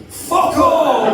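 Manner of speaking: high, shouted punchline; exasperated shout. Acted. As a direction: burst out in mock agony and exasperation. Exasperated punchline about painkillers.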